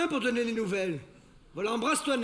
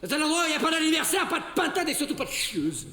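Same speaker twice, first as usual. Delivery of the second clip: angry shouting; 6 semitones higher, faster than usual. Acted. Couple quarrel, he yells back.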